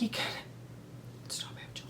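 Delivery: barely audible whispered quote; retold whisper. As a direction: let the voice drop into a whisper. Retold moment, voice drops away.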